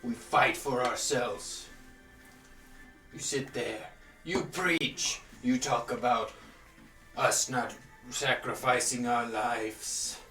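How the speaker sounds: booming, furious giant preaching; fire giant voice. Acted. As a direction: roar it like an angry giant. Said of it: "You hear the fire giant's voice".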